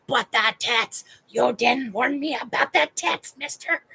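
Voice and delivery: mock scolding, hammed up; indignant complaint. Client complains to her trainer, mock indignant. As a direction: tell him off in comic outrage. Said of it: "That's just how I said it to him".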